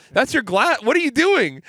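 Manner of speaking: high-pitched incredulous protest; retold exasperation. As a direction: pitch jumps up in baffled disbelief. Exasperated grandson correcting confused grandpa.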